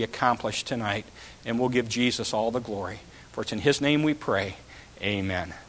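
low, hushed public prayer. Real, not acted. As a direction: pray quietly and reverently over the group. Leads congregation in prayer.

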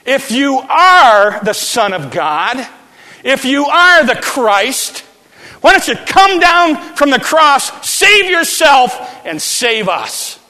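high, loud sneering shout; mocking thief. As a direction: jeer at him slowly, then yell. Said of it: "Jesus and said".

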